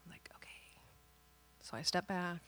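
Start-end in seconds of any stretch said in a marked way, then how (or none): 0.4-0.8 barely audible reply; meek acceptance. Retold or imitated. Fired-up wife yielding to husband, very quietly. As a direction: back down in a tiny, very soft voice.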